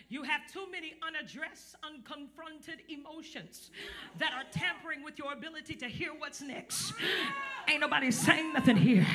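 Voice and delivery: loud, rhythmic sermon, pushing hard to the crowd; hammering the congregation with call and response; fiery preaching. Acted. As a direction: preach it at full force to the room. Preaching to congregation, full force.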